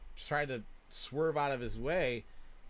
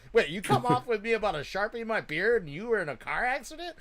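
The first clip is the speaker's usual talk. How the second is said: laughing; 9 semitones higher, 7 dB louder than usual. Retold or imitated. Voicing dad's surprise, laughing.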